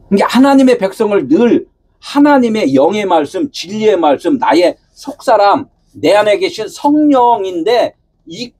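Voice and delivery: ordinary talk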